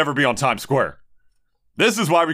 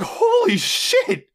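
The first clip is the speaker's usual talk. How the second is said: much higher, slow, drawn-out disbelief; stunned; much slower than usual. Retold or imitated. Reacting to the speedrunner's slur, stunned and drawn out. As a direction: let it stretch out in shock. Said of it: "I was like".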